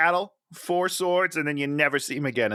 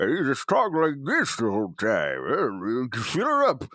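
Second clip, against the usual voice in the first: lightly muffled Bane-like voice; a hint of Bane through a full mouth; 4 semitones lower than usual. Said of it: "put on a slightly Bane voice".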